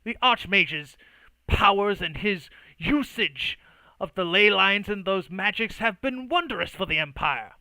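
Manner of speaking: loud, affronted defence; indignant mage. Acted. Mage defending the Archmage to the druid's agent, indignant. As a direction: protest with pitch rising and heavy emphasis. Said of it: "and Uwe Boll goes".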